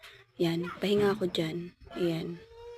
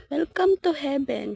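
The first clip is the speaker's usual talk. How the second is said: mock announcement; 6 semitones higher, 6 dB louder than usual.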